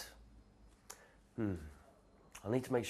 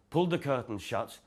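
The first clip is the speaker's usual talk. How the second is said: ordinary delivery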